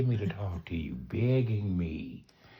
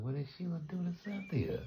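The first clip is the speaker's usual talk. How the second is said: incredulous question; 8 semitones higher, 5 dB quieter, faster than usual. Retold or imitated. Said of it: "I'm like".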